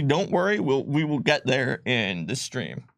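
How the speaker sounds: ordinary talk, nothing marked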